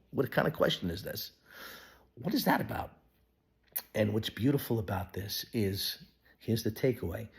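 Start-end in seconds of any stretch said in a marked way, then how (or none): none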